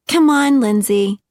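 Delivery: high, loud whining plea; whiny drunk blonde. A narrator's reading. Party girl whining at her friend to keep going. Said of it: "the juicy blonde whined".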